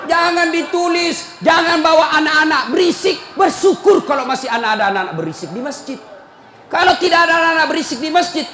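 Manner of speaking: loud, high scolding sermon voice; fiery preaching. Acted. Preacher scolding the mosque committee, loud and fiery. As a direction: preach it hard at the committee, laughing between.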